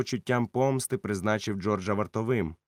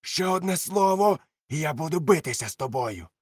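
high, loud furious threat; 6 semitones higher, 4 dB louder than usual. Acted. Silver threatening George, furious and high. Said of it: "крикнув він".

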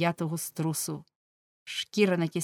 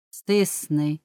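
judge's order; 4 dB louder than usual. A narrator's reading. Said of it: "наказав суддя".

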